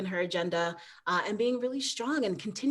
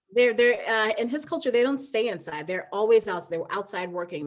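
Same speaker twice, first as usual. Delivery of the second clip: normal talking voice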